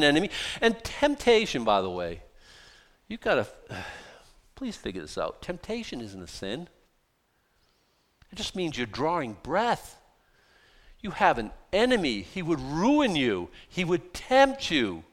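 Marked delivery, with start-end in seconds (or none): none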